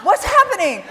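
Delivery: high, loud, laughing "what's happening"; laughing disbelief. Retold or imitated. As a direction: laugh through the baffled question. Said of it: "I was kinda like".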